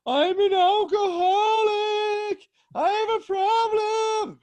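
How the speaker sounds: very high, loud, drawn-out bawling; slow shrieking fake sobs for effect; mock wailing. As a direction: wail a hammed-up drunken confession. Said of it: "wailing in my room".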